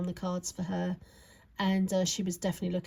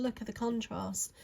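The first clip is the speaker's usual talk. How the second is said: ordinary delivery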